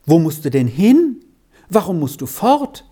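rapid, rising, urgent questions; great agitation. A narrator's reading. Efeli presses Beni about why he must leave, agitated and fast. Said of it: "fragte sie in großer Aufregung".

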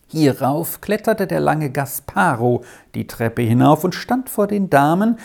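usual speaking voice

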